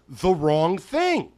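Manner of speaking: the wrong thing, slow and drawn out, every word weighted; slow emphasis. Real, not acted. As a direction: lean hard on the wrong thing, very slowly. Punchline drawn out slowly.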